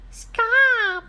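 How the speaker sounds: very high, loud squeaky yell. Acted. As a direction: yell as a little truck. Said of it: "yelled Blue".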